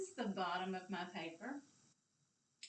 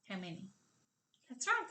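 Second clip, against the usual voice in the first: normal talking voice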